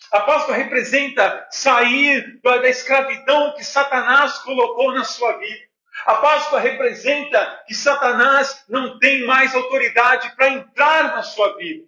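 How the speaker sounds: forceful, measured proclamation; emphatic pulpit delivery with weighty pauses; preaching. Real, not acted. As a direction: hammer each declaration home to the congregation. Preaches the meaning of Passover.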